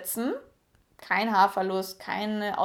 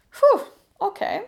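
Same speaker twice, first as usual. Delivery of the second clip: high, loud exhale of mock dismay; overwhelmed puff; 11 semitones higher, 6 dB louder than usual. Real, not acted. Vlogger reacting to turning twenty-eight, overwhelmed puff. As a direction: blow it out like it's a lot.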